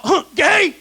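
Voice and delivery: loud, high shout from a passing driver; mock drive-by holler; yelled heckle. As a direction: imitate someone yelling from a car. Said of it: "One guy even yelled out".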